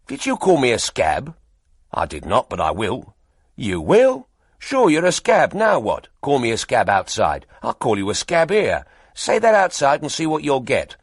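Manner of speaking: loud, belligerent quarrel; squabbling photographers. Acted. Rival photographers trading insults, loud and belligerent. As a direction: square up for a fight.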